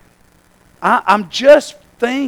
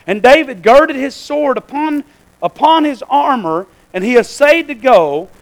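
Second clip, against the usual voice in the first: raised, emphatic pulpit reading; scripture reading; 5 semitones higher, 4 dB louder than usual. Acted. Preacher reads David and Saul passage to church, scripture reading. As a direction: read the Bible verses out to the congregation.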